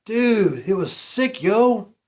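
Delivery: high, loud dude voice; hyped movie dude. Retold or imitated. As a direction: rave like an impressed bro. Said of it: "And the guy goes".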